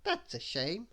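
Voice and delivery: restrained bossy character voice; Little Miss Bossy. A narrator's reading. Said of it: "she replied".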